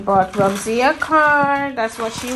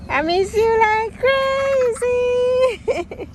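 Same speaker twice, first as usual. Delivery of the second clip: tearful; 14 semitones higher, much slower than usual. Real, not acted. Mother tearful on reunion.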